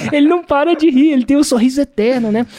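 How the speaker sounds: high, loud, laughter-lifted voice; amused. Real, not acted. Guest joking about his ever-laughing friend, amused. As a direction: exclaim it straight out of a laugh.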